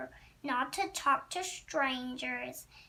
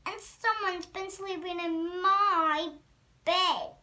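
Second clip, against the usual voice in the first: Mama Bear, higher and louder; medium-sized voice; 4 semitones higher, 6 dB louder than usual. A narrator's reading. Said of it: "Mama Bear in her medium-sized voice".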